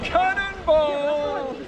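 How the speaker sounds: high, drawn-out cannonball yell. Real, not acted. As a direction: stretch it out as you leap. Jumping into the sea.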